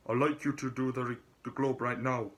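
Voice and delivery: put-on half-hearted Scottish accent retry. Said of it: "what Scottish accent".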